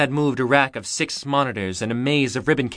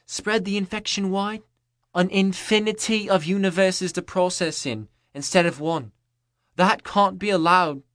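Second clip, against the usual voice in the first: a lightly voiced, higher female voice, coolly scornful; Eve's voice; 6 semitones higher than usual. Eve lectures the captors.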